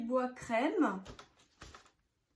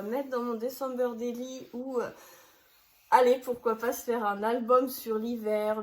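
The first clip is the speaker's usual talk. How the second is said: ordinary talk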